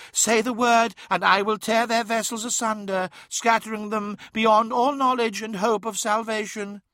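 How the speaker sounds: high, otherworldly tempter; high, sustained reflection voice; fading reflection's voice. A narrator's reading. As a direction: pitch it well up, an eerie offer of destruction. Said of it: "said the fading reflections".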